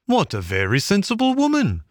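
high, polite gentleman voice; an obliging motorist gentleman. A narrator's reading. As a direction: pitch him up as a kindly gentleman. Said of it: "said the gentleman".

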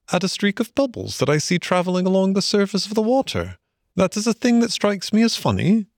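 high-pitched, wondering mole character; curious Mole. Acted. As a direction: puzzle aloud in a high voice.

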